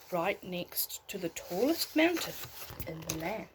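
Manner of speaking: put-on gentle storybook read for a child at bedtime; bedtime story narration. A narrator's reading. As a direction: tell it like a bedtime tale, unhurried.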